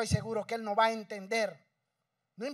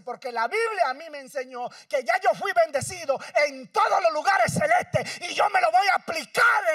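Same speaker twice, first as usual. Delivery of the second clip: clamor fuerte y agudo del predicador; predicación a todo pulmón; 5 semitones higher, 9 dB louder than usual. Acted. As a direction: proclama con fuerza al pueblo. Preaching at full force. (predicador gritando con fervor)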